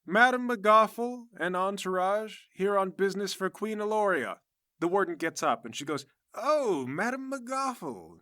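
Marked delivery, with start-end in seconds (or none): 0.0-4.4 high, loud herald's announcement; guard proclaiming an arrival; formal announcement. Acted. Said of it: "The guard announces". 6.3-8.2 high, drawn-out gush of recognition; fawning, stretched-out "oh" and name; effusive greeting. Acted. Warden greets a royal guest, bowing.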